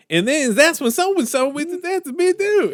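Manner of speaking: high, slow nasal-free Tarantino mimic; Tarantino impression. Friend imitating Tarantino in an interview, high and slow. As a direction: ham up a fast-talking director's voice. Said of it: "have you ever seen Quentin Tarantino".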